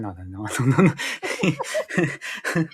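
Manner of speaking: slow, stretched nine ninety-nine; hammed-up infomercial price tag; drawn-out mock sales pitch.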